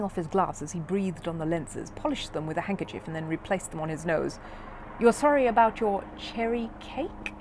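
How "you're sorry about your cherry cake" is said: slow, careful puzzled repeat. A narrator's reading. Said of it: "he repeated carefully".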